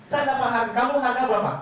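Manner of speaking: retold anger, pitched well up; mock indignant. Friend offended at being told he has no price, mock indignant. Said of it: "Dia marah loh, sempat marah loh".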